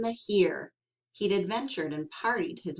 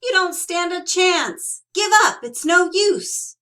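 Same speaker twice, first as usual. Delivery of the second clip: much higher and louder holler; 10 semitones higher, 9 dB louder than usual. Acted. Bruce hollering back at Sorrel over the cone. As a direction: yell him down. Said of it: "hollered Bruce".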